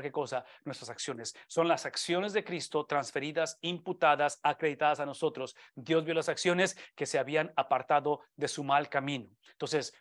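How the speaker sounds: usual speaking voice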